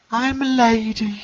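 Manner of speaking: slow, high mock lady voice. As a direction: prance it out like a lady. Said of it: "you, uh, get that voice".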